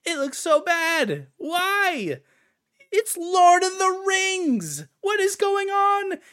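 high, slow, strained disbelief; exasperated squeal. Real, not acted. Gamer ranting to viewers about ugly art, high exasperated wail.

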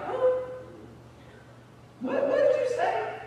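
very high, mocking boss voice with a laugh; manager's tone. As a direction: imitate a condescending boss, high-pitched. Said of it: "his tone that was like".

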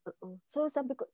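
ordinary talk

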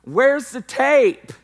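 high, loud, slow teasing call to his wife; hammed-up playful yell across the room; mock shouting joke. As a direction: ham it up as if shipping the kid off. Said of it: "I go".

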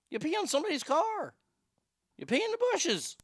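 very high-pitched incredulous rant; exasperated scolding. Acted. Host scolds passengers for peeing in a bag, high exasperation. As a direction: pitch it way up, lecturing in disbelief.

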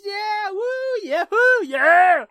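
high-pitched, hammed-up cheering; mock celebration, rising loud at the end; rowdy whooping. Whooping after a joke.

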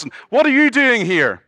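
high, loud, incredulous challenge; indignant angel. Retold or imitated. Angel confronting the thief at heaven's door, high and loud. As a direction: bar the door like a shocked gatekeeper.

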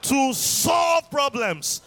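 slow, loud drawn-out shout; shouted climax. Acted. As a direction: stretch it into a shout.

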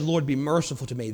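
soft repentant murmur; humble quiet plea. Retold or imitated. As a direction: plead low as if you can't lift your eyes. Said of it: "couldn't even lift his eyes and just said".

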